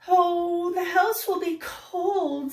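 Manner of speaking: a touch of a worried sigh. Acted. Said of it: "sighed the rabbits".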